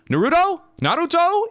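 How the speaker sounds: high incredulous Naruto, twice; squeaky mock surprise; surprised Naruto?. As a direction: echo it back in mock disbelief.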